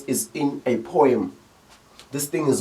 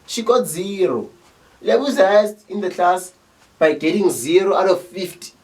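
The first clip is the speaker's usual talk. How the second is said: sarcastic mockery; 6 semitones higher, 4 dB louder than usual. Teacher sneering at a pupil's zero, mock praise.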